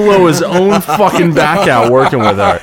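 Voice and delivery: high, loud, laughing insistence. Real, not acted. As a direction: protest through laughter. Laughing accusation.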